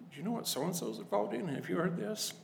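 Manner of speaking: high, hushed gossip; gossipy hush. Retold or imitated. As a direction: lean in and spread the rumour softly. Gossiping to Leslie about someone.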